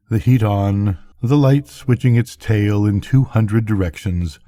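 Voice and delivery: ordinary delivery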